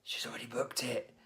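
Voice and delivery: urgent whisper to a colleague; hushed, voiceless aside. Retold or imitated. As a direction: whisper it like a secret in the office. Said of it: "I'm whispering to my colleague".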